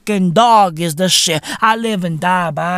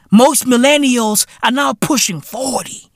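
ordinary talk

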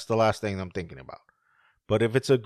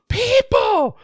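high, loud shriek; cartoon scream; 9 dB louder than usual. Monster sees the camera and shrieks in panic. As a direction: shriek it like a startled cartoon critter.

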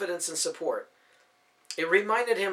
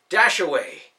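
louder command; 9 dB louder, slower than usual. Acted. Second try, louder.

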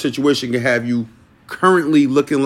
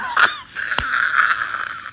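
long squealing laugh; high breathless laugh; 5 dB quieter than usual. Real, not acted. Laughing after naming the episode.

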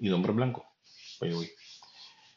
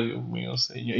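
mock apology; 7 dB quieter than usual. Horrified apology to class.

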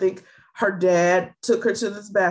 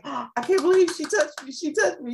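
put-on high, giddy voice of a starstruck child; excited little girl; 9 semitones higher, faster than usual. Fan girl telling her dad, starstruck and giddy. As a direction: squeal it like a thrilled young fan. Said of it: "She's like".

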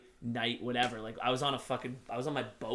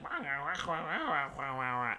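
gibberish. Retold or imitated. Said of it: "they go like".

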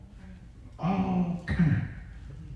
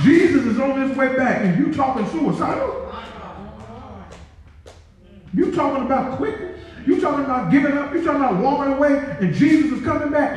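high, fast, rising preacher's challenge; rapid-fire sermon questions; preaching at full force; 11 semitones higher than usual. Acted. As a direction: press the congregation hard, pitch climbing.

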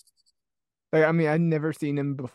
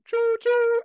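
train whistle imitation.

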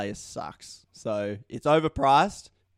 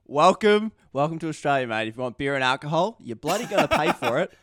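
mock Aussie welcome; 3 dB louder than usual.

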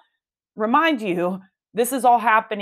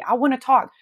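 pleading husband; 4 semitones higher than usual. Retold or imitated. Husband silently begging wife to talk, lightly voiced plea. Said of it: "he kept telling me".

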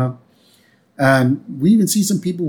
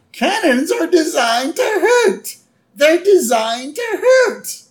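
high, slow imitated wail; worried wife; slower than usual. Wife warning that cannons hurt, worried wail. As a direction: plead high and drawn out. Voicing worried Marge pleading.

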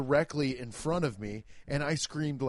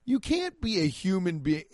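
usual speaking voice

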